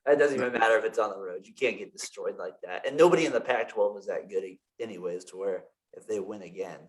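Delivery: normal talking voice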